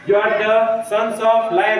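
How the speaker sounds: every word slow and weighty; proclaiming slowly. Acted. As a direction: lay each word down like a vow. Teacher proclaims Vivekananda's message.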